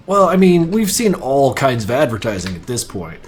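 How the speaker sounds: normal talking voice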